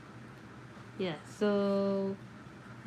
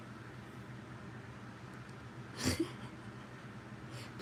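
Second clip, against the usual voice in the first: a long, soundless breathy laugh; 4 semitones higher, 13 dB quieter than usual. Real, not acted. Streamer laughing quietly at a chat remark, breathy laugh. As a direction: laugh almost silently, all breath.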